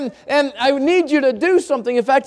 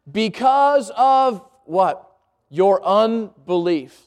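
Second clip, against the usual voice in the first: slow emphatic answer; 3 semitones lower, much slower than usual. Acted. Preacher asks, then answers himself.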